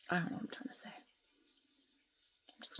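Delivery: breathless whisper, slowing down at the end. Real, not acted. Overwhelmed, trailing into whisper.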